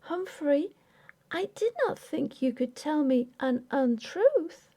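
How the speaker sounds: put-on high, trembling young wife; hurt and shaky, holding back tears; wife trembling as she accuses her husband. A narrator's reading. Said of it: "Her voice trembled".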